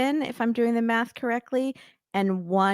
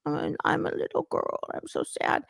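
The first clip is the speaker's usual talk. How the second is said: whispered, mock-sad little-girl pout; pouty little girl; 6 semitones higher, 6 dB quieter than usual. Co-host mocks her airport pout, whispery little girl. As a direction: whisper it softly through a pout. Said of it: "she does the pouty face at the airport".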